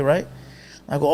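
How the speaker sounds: normal talking voice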